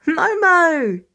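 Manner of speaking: bright, loud call of a name; joyful call. Acted. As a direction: call out to the baby with delight. Teen greets baby sister joyfully.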